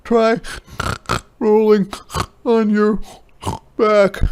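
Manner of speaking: high, loud snorty pig voice; snorting pig. A narrator's reading. Ping the pig gives advice, loud and snorty. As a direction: bark it out like a bossy pig. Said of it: "snorted Ping".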